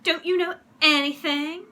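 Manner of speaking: high, loud scolding question; indignant bluffing comeback; scornful retort. A narrator's reading. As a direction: talk down to a doubter. Henry bluffing, scolds doubting Margaret.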